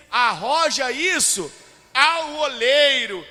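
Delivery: loud, high ironic command, drawn out; ironic proclamation. Acted. Preacher quoting the Lord's words to the congregation, ironic. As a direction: proclaim it with biting irony. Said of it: "talvez com uma ironia".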